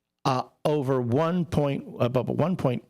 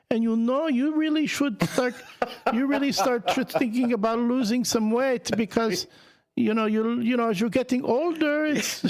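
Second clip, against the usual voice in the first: much higher, an older Puerto Rican mom's accent, badly done; 10 semitones higher than usual. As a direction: imitate his mom in a clumsy Puerto Rican accent. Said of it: "that's a bad, uh, older Puerto Rican woman accent".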